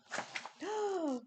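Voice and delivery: a quiet, breathy gasp of surprise; soft gasp of wonder. Acted. Mum reading to Ryan, gasps at the rain. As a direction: gasp softly as the rain begins.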